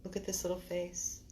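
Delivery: soft cooing to a little puppy; tender soothing. Real, not acted. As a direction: coo gently and reassuringly.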